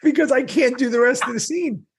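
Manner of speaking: high, laughing plea to call lunch; laughing through it. Retold or imitated. Actor begging the crew through giggles, laughing. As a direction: squeal it out while cracking up.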